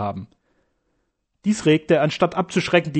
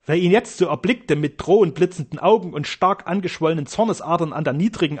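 usual speaking voice

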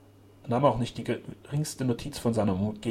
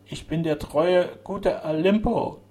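very slow, loud, raised mechanical intoning; talking machine voice; 7 semitones higher, 6 dB louder, slower than usual. A narrator's reading. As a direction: drone it out like an artificial speaking machine. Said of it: "welche dem Erzeugnis einer künstlichen Sprechmaschine glich".

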